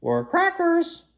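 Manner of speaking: very high parrot squawk. Acted.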